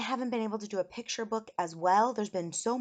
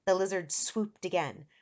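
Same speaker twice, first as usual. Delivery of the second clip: plain reading voice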